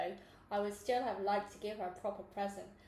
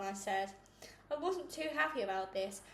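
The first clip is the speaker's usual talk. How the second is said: usual speaking voice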